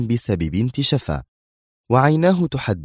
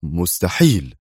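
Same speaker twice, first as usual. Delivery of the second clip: stern; slower than usual. A narrator's reading. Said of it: "وهو يجيب في صرامة قاسية".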